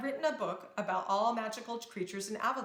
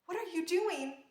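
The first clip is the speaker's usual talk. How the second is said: mild alarm; 8 semitones higher than usual. Acted. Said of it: "Isabel was afraid for her sister".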